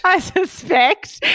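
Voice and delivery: high drawn-out playful echo; a teasing high echo of her friend; mock echo. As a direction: repeat it way up and slow, teasing.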